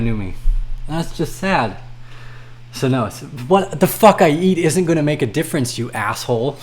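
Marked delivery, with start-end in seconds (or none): none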